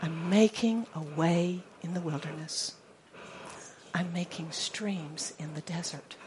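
soft, whispered voice of God; whispered promise; hushed scripture. Acted. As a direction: breathe the promise. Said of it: "this is what it leads to".

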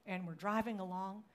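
ordinary talk, nothing marked

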